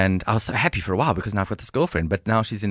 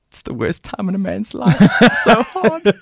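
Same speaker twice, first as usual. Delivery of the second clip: high, loud mock lament with laughter around it; hammed-up suffering husband; comic exasperation; 11 semitones higher, 9 dB louder, slower than usual. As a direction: wail it as a joke for the audience. Comic lament over partner's pregnancy.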